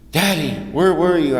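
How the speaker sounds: lightly voiced daughter calling to her daddy; little girl voice. Daughter jumping into father's arms, lightly imitated child.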